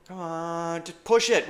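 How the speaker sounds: usual speaking voice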